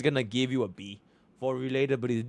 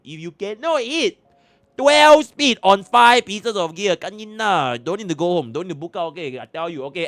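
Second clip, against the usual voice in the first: put-on Singlish accent; 6 semitones higher, 6 dB louder than usual. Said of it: "to talk in that accent".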